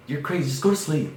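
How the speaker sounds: imitated irritated parent yelling back; exasperated parent. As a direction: snap it like a fed-up parent. Voices parents dismissing him.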